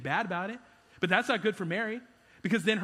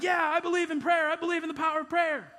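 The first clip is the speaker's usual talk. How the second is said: eager believer; 8 semitones higher than usual. Retold or imitated. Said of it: "we would all be like".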